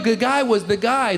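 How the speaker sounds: ordinary talk, nothing marked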